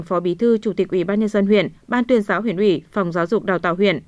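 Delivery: usual speaking voice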